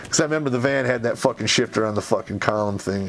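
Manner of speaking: ordinary talk, nothing marked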